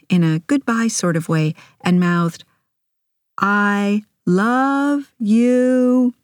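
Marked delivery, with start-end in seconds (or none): 3.4-6.2 very slow, drawn-out words at raised pitch; slow mock shout. A narrator's reading. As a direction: stretch each word as if mouthing it to someone far away. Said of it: "slow, like I was shouting it".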